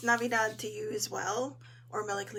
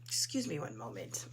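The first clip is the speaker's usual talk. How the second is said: hoarse voice; 9 semitones lower, 5 dB quieter than usual. Real, not acted. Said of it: "My throat is determined to have the better".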